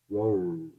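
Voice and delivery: "ro" low and soft, English R alone; r syllable demo. Shown as a demonstration. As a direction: isolate "ro" as in world. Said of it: "Esse som do r". ("ro" isolado, o R do inglês)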